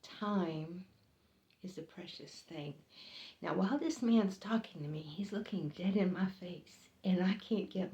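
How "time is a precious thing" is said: quiet, grave, almost far away; hushed solemn stranger. Retold or imitated. Mysterious stranger telling the clerk about time, hushed. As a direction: drop soft and distant on the words. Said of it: "And he said".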